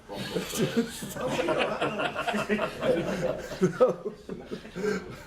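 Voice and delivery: long real laugh at his own joke; helpless giggling after a bad pun. Real, not acted. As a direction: crack up at your own punchline. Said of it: "I always laugh at my own jokes".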